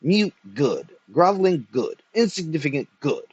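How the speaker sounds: theatrical outrage; apoplectic scorn. A narrator's reading. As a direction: spit out each phrase with mounting contempt. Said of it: "He grew apop-, apoplectic".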